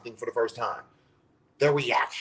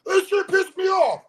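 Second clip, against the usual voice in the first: high, hammed-up angry yell; an exaggerated mock tantrum; mock angry outburst; 5 dB louder than usual. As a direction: blow up in fake outrage. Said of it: "Me reacting to something is just".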